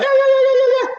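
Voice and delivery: high mocking yeah yeah yeah; mock falsetto yeah. Host mocking Fina Bono's prophesying, in a high mock squeal.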